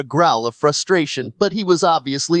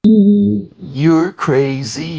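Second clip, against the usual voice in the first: very slow, stretched, distorted line; warped slow-motion voice; strange slowed voice; 2 semitones higher, 3 dB louder, much slower than usual. Acted. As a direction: drag it out eerily slow. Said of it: "what the fuck was that?".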